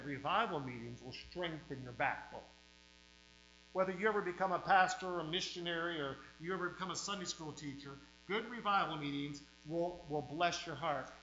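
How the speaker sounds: usual speaking voice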